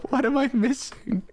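high, slow, half-laughing wail; frustrated whine. Real, not acted. Gamer pleading with the game for honey, high whine. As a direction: whine each question in despair.

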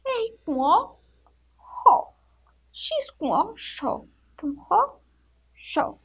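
ró and xó each set apart after naming letters; sounding out syllables. Shown as a demonstration. Teacher showing pupils how letters join into ró and xó. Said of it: "Vocês colocam assim".

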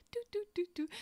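high sing-song "do, do, do", copying the click of heels; tapping out her steps in a high little voice; high mock heel clicks. Imitating walking in little heels.

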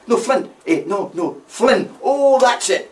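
high, loud bursts of guesses; mock eureka, rising in pitch and volume; excited guessing. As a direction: act out hitting on the name at last. Acting out men guessing the name.

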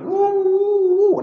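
high, held "wooo" wail; one long high-pitched mock howl of grief; imitated wailing. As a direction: mimic a mourner's loud keening. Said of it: "sahabat jerit".

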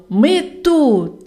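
joyful call. A narrator's reading. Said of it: "радісно всі гукати разом".

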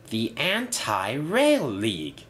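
slow, savoured name; slow repeat. A narrator's reading. Inspector fascinated by the bus's idea, slowly repeating. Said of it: "he repeated".

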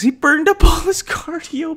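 high, giggling squeal on cardio; laughing repeat. Real, not acted. Retelling the movie to viewers, cracking up high. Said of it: "me and my sister are howling at this".

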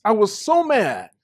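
ordinary talk, nothing marked

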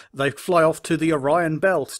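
ordinary talk, nothing marked

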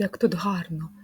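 ordinary talk

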